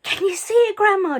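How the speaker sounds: high, loud call; shouted. A narrator's reading. George shouting up to Grandma. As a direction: call up to the roof. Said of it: "George shouted".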